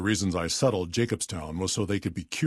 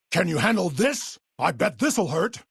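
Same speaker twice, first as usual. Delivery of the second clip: high-pitched combat goading; attack taunt; 9 semitones higher than usual. Acted. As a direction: taunt while striking.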